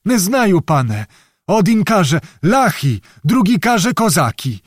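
put-on high, pleading old peasant; old beggar. A narrator's reading. Old beggar tells which knights he heard of, high and helpless. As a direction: report hearsay in a high, helpless voice.

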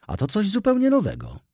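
wysoko, zdumiona drwina; ironiczne zdziwienie. Acted. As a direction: dama udaje zaskoczenie. Ironic surprise. (ironiczne zaskoczenie, wysoko)

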